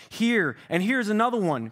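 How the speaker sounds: wailed. A narrator's reading. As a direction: cry out in dismay.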